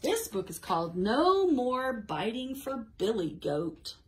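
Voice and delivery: animated storytime voice with big swings on key words; reading to children. A narrator's reading. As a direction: read a story aloud to small children. Reads picture book to children.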